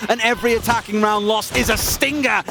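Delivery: ordinary delivery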